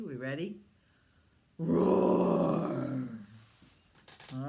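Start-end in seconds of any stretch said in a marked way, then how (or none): 1.6-3.1 big loud roar; dragon roar. Pretend. Parent leads child in a loud dragon roar.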